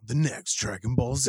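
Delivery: mock episode-preview narrator; hammed-up cartoon announcer voice; Dragon Ball Z announcer. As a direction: tease it like an anime next-episode promo. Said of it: "what's the one from Dragon Ball Z".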